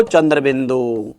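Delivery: normal talking voice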